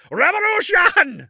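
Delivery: the student yelling his one answer to the school; mock revolutionary shout.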